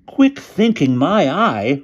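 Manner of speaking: high, loud, derisive outburst; scornful retort. A narrator's reading. Boy rejects praise of his friend, loud retort. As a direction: throw the words back loudly in disbelief. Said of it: "retorted Wilbur".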